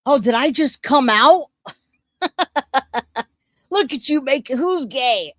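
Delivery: usual speaking voice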